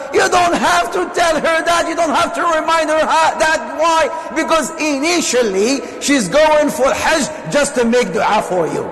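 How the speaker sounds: preaching. Acted. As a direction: press the point home to the congregation. Preacher raises voice on mothers' sacrifice.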